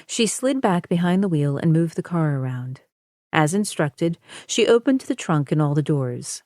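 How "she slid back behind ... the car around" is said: plain reading voice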